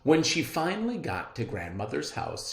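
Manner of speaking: ordinary delivery, nothing marked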